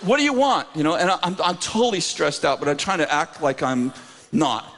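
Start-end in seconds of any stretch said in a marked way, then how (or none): 0.0-0.7 higher-pitched imitated demand; gruff challenge. Reluctant husband retold to the congregation, gruff demand. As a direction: mimic the irritated husband. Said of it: "so he goes".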